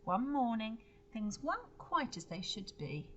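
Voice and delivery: usual speaking voice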